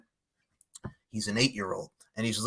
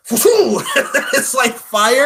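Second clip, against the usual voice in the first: laughing; 10 semitones higher, 11 dB louder, much slower than usual. Real, not acted.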